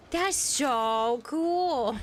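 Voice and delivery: put-on high, very slow kid awe; drawn-out childlike wonder; little girl voice. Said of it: "She said".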